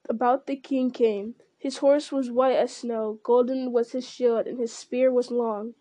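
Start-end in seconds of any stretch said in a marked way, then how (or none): none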